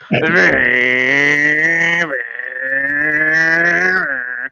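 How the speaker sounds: long, sustained wailing drone; imitated odd noise. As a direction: hold one droning cry, swelling and dipping. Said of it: "he's going like this".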